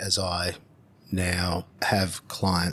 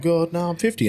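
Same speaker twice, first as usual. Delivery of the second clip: high, loud horrified gasp of words; mock horror; 10 semitones higher, 6 dB louder than usual. Realising age as clients grow younger, high and loud. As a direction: recoil at your own age, loud and up high. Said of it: "And I'm like".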